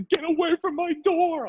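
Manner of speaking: very high mock child voice; shrieking kid. Kid warning off the neighbour, high-pitched yell. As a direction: imitate a child yelling through a door. Frightened kid behind door, retold.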